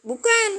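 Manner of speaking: "bukan" jumps high with a rising tune; stress on bukan. Shown as a demonstration. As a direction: lift "bukan" sharply up as the key answer. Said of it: "maka jawabannya". ("bukan" diucapkan tinggi dan naik)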